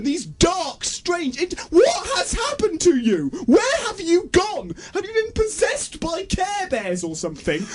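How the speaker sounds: high-pitched incredulous outcry, drawn-out key words; exasperated tirade. Acted. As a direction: plead with the director in hammed-up despair. Exasperated rant at the director.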